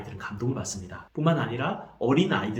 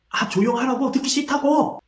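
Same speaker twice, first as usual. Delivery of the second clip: high, louder imitated snap at the parent; mock yell to be left alone; child lashing out in an imitated rage; angry outburst; 12 semitones higher, 6 dB louder than usual. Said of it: "이렇게 화를 내버립니다".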